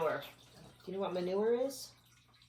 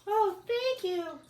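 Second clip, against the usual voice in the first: high, slow bug thanks; high grateful bug; 14 semitones higher, 6 dB louder, slower than usual. Acted. Reading to a child, the bug thanks the worm, high and slow. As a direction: thank them sweetly as a tiny bug. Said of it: "said the smelly little bug".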